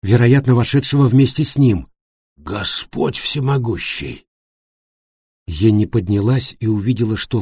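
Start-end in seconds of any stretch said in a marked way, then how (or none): 2.4-4.2 slow, hushed appeal to God; muted, awestruck dismay; shocked exclamation. A narrator's reading. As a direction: say it softly and slowly, aghast. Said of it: "возглас Йоханнеса".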